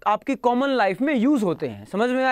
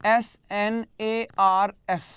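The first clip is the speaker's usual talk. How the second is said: slow spelling; slower than usual. Shown as a demonstration. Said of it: "एक word इसमें होता है".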